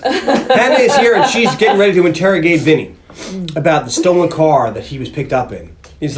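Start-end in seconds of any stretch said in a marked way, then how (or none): none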